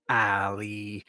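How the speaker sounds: lightly voiced old woman calling a name; a hint of eerie glee; gleeful naming. Old woman naming Ali to claim power, light eerie call. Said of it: "like, naming him".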